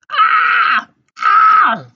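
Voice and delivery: piercing, very loud high scream; two earsplitting screams; shrieking scream. A narrator's reading. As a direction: shriek in horror at full volume. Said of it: "she yelled".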